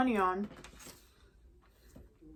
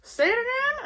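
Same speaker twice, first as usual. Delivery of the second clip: very high, loud, thrilled squeal; giddy high-pitched cheering; excited squeal; 6 dB louder than usual. Retold or imitated. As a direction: squeal like you can't contain the joy. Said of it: "they start flipping out".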